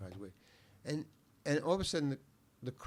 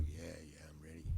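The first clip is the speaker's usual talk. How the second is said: soft, lower, barely-there yes; a nervous actor whispering he's ready; meek whisper; 9 semitones lower, 16 dB quieter than usual. Retold or imitated. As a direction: shrink and answer in a tiny voice. Nervous actor answers director.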